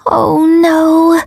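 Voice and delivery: high-pitched little wail of dismay; mortified, high-voiced groan. A narrator's reading. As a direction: let it out as an embarrassed moan. Narrator dismayed at her mistake.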